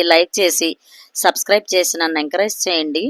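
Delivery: ordinary delivery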